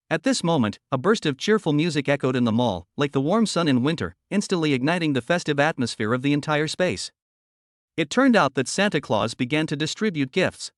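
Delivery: plain reading voice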